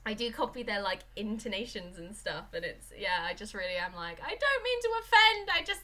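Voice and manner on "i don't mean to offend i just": high, loud mock-anguished plea; hammed-up high-pitched protest; panicked apology. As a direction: wail an apology in comic panic. Acted apology to offended friends.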